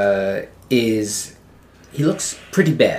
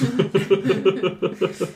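laugh; 8 semitones higher than usual. Real, not acted. Laughing at joke.

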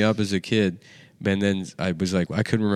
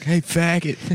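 mock prank caller; 8 semitones higher, much slower than usual. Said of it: "somebody's like".

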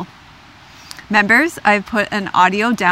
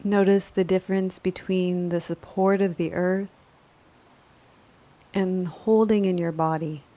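calm, measured yoga nidra guidance; unhurried, even-toned relaxation voice; guided meditation; 2 semitones lower, 7 dB quieter than usual. Acted. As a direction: guide a resting listener gently, with long pauses between phrases.

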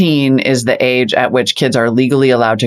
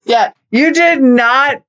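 high, drawn-out playful scolding; mock disbelief; 10 semitones higher, much slower than usual. Real, not acted. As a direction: stretch it out high, teasing the co-host. Co-host mock-scolds an innuendo.

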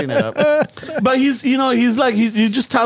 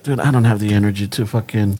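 exhausted friend; 10 semitones lower than usual. Retold or imitated. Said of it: "homeboy's like".

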